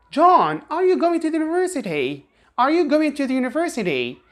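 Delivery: British question tune with a high rise at the end; British rising tune. Shown as a demonstration. Teacher demos a British question melody, rising high. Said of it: "they have this melody plus going up at the end".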